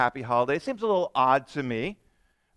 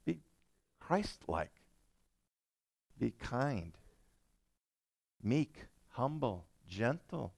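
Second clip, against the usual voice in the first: gentle; 10 dB quieter, slower than usual. Real, not acted. Soft contrast to the outburst.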